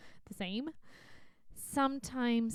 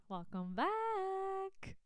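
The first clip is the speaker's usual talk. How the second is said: drawn-out welcome; 8 semitones higher, 3 dB quieter, much slower than usual. Real, not acted. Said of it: "I love to say this".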